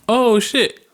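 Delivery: sudden panic as the ball flies; startled. Retold or imitated. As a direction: caught off guard, yelping oh, shit. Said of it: "And I'm like".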